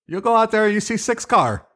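very high mock foreign accent; broken English in a comic fake accent; Borat. Mocking the accent's missing plurals, high Borat-like voice. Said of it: "nothing's ever plural".

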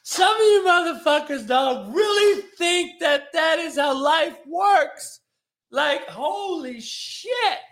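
high, loud, slow disbelief; exasperated outburst. Real, not acted. Host venting at naive listeners, high and loud. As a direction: cry out at how naive they are.

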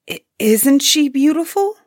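high, hesitant question with a rising end; faltering. A narrator's reading. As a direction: stumble at the start, then ask timidly. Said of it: "Osmo faltered".

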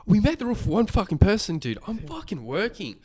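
high-pitched, worked-up retelling of an outburst; losing it, retold. Protesting to housemate about the plate, losing it. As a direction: re-enact losing it, voice pitched right up. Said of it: "I was losing it".

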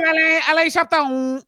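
very high mock French accent; dumb French voice. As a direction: say the name in silly, squeaky French. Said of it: "in a dumb French voice".

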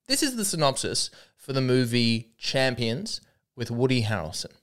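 normal talking voice

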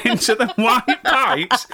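words broken by laughter, slow; laughing. Real, not acted. Joking about the royals' blocked toilet, laughing through the words.

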